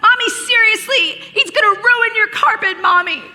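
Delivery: high, loud imitation of a panicking kid; hammed-up, high-pitched kid in alarm; freaking out. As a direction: mimic a frantic child yelling for mommy. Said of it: "Noah starts freaking out".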